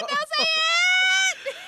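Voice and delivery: shouting out. Real, not acted. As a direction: yell it to stop a spoiler. Stopping a spoiler.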